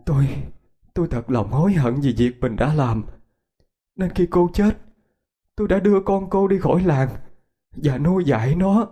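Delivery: high, halting confession; choked with emotion. A narrator's reading. Woman confessing remorse to the mother's ghost, choked up. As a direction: remorse catching in the throat. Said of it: "nói với giọng nghẹn ngào".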